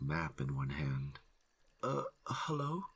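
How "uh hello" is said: high timid hello; unsure lost man; nervous hiker voice. A narrator's reading. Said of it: "he says".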